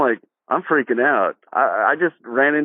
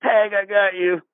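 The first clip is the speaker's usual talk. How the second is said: higher, teasing playground taunt; mock the fallen rider like a gloating kid; a kid's taunt, lightly voiced; 9 semitones higher than usual. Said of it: "somebody says".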